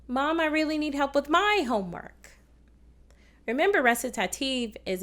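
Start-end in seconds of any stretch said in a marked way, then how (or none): 0.1-2.4 "my" lifted high within the line; stress on MY. Shown as a demonstration. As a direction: put the accent on "my". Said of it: "what happens if I put it my".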